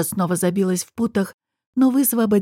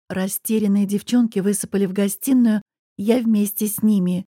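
usual speaking voice

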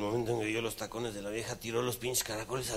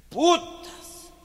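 put-on high loud drunken yell; drunken high-pitched shout; mock shout; 9 dB louder than usual.

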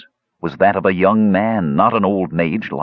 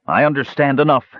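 mild snarl, higher voice; 7 semitones higher than usual. A narrator's reading. Kishpa rejects Brandella, mild snarl. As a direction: bite the words back at her. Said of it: "he snarled".

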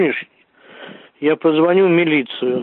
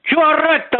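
raised, furious yell; angry yelling; 7 semitones higher than usual. Real, not acted. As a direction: shout down the caller. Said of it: "А ты хули орёшь там".

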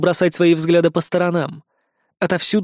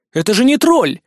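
shouted high, stressing тролль; yelling; 5 semitones higher, 4 dB louder than usual. Acted. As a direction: yell it in disbelief. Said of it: "заорал я".

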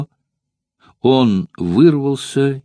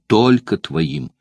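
ordinary delivery, nothing marked

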